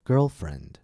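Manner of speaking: put-on "Girlfriend" with GIRL punched, friend falling; stress on first noun. Shown as a demonstration. Teacher leading listen and repeat, "Girlfriend" stressed on GIRL. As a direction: hit "Girl", drop "friend". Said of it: "Stress the first noun".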